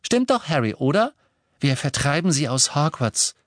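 cheerfully seek agreement; chirpy. A narrator's reading. Luna turns to Harry for confirmation, chirpy. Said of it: "sagte Luna munter".

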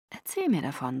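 soft, low urging. A narrator's reading. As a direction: coax gently. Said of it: "drängte er".

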